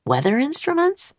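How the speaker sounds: high rising surprise on instruments; Jack, puzzled and intrigued; curious question. A narrator's reading. Jack hears about the weather instruments, curious rise. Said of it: "said Jack".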